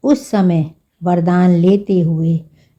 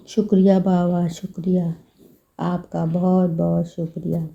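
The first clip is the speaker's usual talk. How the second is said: much softer, hushed thanks, slowing into the closing; soft grateful thanks; 5 dB quieter than usual. Real, not acted. Reader softly thanks Baba after the reading, hushed and devout. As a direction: murmur the thanks as a devout farewell.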